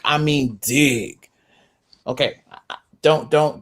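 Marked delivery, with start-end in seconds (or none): 0.0-1.3 slow, with "dig" drawn out and weighted; slow down and land on "dig"; emphatic slow "dig"; stress on dig. Shown as a demonstration. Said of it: "when I say dig".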